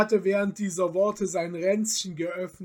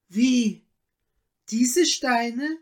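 higher, startled questioning cry; startled exclamation; 5 semitones higher, 4 dB louder, much slower than usual. A narrator's reading. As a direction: break off in astonishment, pitch lifting. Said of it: "rief sie".

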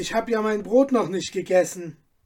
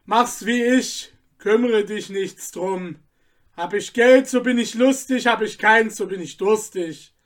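put-on drunk cobbler ranting; 4 semitones higher, 6 dB louder than usual. Anton rants at his wife about money, a loud drunken rant. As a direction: roar out the drinking motto.

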